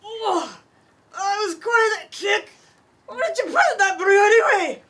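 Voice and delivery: dwarf lass stunned by the drink; dwarf lass jolted. Acted. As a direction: reel from a strong brew. Dwarf reacting to strong brew.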